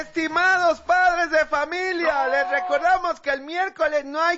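high, declaimed official notice; reading a speech aloud. Acted. Principal reading the wrong paper to parents, declaiming. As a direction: proclaim it like a grand speech. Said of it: "Y empieza a leer".

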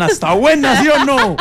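high, eager asking. Retold or imitated. Diana eagerly asks him if the arepa is good. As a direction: ask eagerly if the food is good. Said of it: "Y me decían".

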